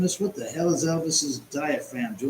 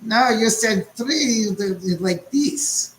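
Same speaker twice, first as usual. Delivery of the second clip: put-on German accent; 8 semitones higher, 3 dB louder, slower than usual. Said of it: "from Inglourious Basterds".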